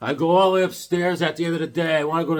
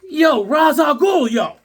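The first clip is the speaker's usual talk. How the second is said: higher, louder, slow disbelieving yo; shocked outcry; 11 semitones higher, 6 dB louder, slower than usual. Real, not acted. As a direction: call out the villain in disbelief. Shocked at baby thrown out.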